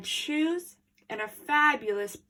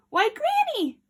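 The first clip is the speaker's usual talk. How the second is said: put-on surprised girl; 5 dB louder than usual. Little Red surprised.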